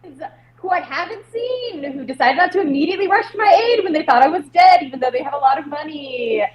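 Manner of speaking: high emcee patter with a pointed dig; stage announcer. Acted. Performer presenting neglectful friends to the audience, showy announcer. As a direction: introduce guests grandly while airing a grievance. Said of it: "I call out to the audience".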